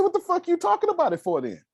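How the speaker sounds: high-pitched incredulous comeback; retold exasperation. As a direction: act out losing patience with a friend. Re-enacting exasperated reply to Grace.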